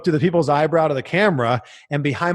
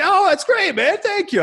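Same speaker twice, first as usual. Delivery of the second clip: much higher, lightly voiced [name]; imitating [name]. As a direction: toss it off bright and high. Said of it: "He's like".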